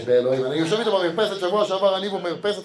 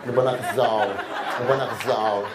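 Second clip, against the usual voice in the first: laughing mock whine; 9 semitones lower than usual.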